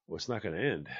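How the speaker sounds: low retold self-talk; talking to himself. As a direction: mutter to yourself in mild amazement. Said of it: "talk to themselves".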